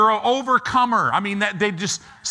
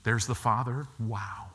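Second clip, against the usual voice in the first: hushed, much softer, in awe; awed whisper; 9 semitones lower, 8 dB quieter than usual. Retold or imitated. Believer meeting Jesus, hushed and awed. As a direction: breathe it out in wonder. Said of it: "They'll look at Jesus and go".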